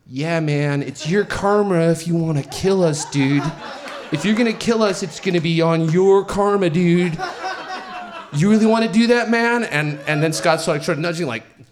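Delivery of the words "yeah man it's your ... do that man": imitated hippie, rising in pitch and volume; hippie mode. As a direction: play a hippie pleading karma, getting louder. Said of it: "switches into hippie mode".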